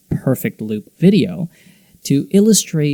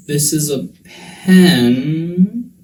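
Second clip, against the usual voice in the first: very slow, PEN stretched and climbing; slow-motion rise on PEN; drawn-out intonation; 3 semitones higher, 4 dB louder, much slower than usual. Shown as a demonstration. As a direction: draw PEN out in a long rise. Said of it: "some examples that I thought were fun".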